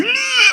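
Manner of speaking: very high squeal of pain; mock pain squeal. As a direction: squeal like a pinched man. Man squeals as muscle is gripped. (gritinho agudo de dor, imitado)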